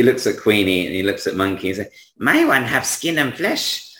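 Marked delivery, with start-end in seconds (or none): 2.2-3.9 high, slow odd shopkeeper voice; toymaker Blinksy. Acted. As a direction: pitch your pet in a high, drawn-out voice.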